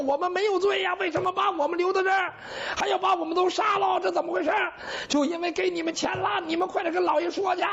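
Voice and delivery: high, frantic pleading cries; desperate high shouts of innocence; panicked shouting. Acted. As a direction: yell it as terrified prisoners. Relatives panic at death sentence.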